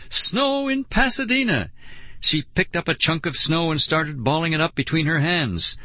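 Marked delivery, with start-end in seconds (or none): 0.1-1.7 very high, thrilled squeal; elated cry, voice way up. A narrator's reading. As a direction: squeal it out in delight. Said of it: "Lauren squealed".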